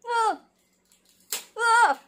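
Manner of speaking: panicked shrieks that build; scared to look at the second photo, rising yelps; freaking out. Real, not acted. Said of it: "Freak out for nothing".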